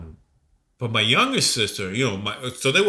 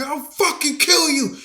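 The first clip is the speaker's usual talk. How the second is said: high, loud, slow death threat; furious threat; 5 dB louder than usual. Retold or imitated. Teen with a knife threatening stepdad, furious. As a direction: spit it out in a rage.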